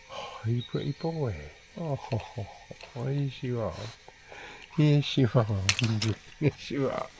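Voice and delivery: soft cooing to the dog with laughs; gentle doting pet talk. Real, not acted. Talking to the dog.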